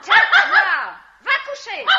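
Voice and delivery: high, loud shoo; shooing call. Acted. Drunk woman loudly sending her little dog to bed.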